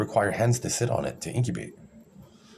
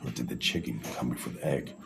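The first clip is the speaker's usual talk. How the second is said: mock pondering whisper; 8 semitones lower, 7 dB quieter than usual. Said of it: "you're like".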